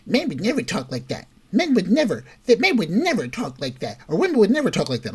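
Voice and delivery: high, insistent imitation of her protest; mimicked outraged woman. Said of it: "She says".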